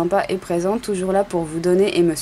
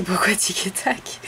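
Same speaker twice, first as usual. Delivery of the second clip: chuchoté; 4 dB quieter than usual. Real, not acted. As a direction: question lente et chuchotée. Question glissée à voix basse. (en chuchotant)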